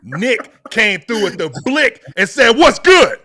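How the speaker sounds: loud, high hype-man shout. Acted. Celebrating the re-signing to Brad, loud hype shout. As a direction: announce his return like a hype man. Hyped announcing Nick's return.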